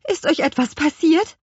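high, anxious question; worried. A narrator's reading. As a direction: ask with urgent concern. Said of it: "fragte Petronella besorgt".

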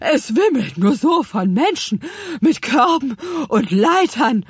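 outraged, breathless little creature; high, loud indignant panting; Spargelzahn. A narrator's reading. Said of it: "Spargelzahn war sehr empört". (empört und außer Atem)

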